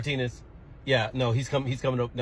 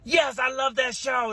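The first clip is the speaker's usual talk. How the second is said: put-on high, loud excited child's shout; excited kid; 13 semitones higher, 4 dB louder, much slower than usual. Excited child shouting about his favorite show. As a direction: squeal it like a thrilled little boy. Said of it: "Gavin instantly got excited".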